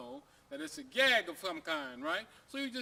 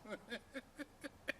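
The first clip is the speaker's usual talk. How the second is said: soft laugh; 4 semitones higher, 10 dB quieter than usual. Real, not acted. Storyteller laughs at own joke.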